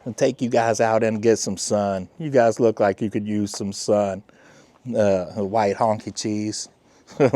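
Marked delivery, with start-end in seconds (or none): none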